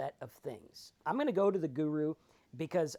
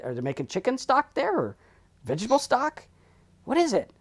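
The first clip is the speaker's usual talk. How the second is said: climbing higher and louder in confusion; bewildered guru; 10 semitones higher, 7 dB louder than usual. Acted. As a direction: play dumb about the stock market.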